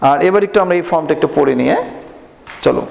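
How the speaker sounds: ordinary talk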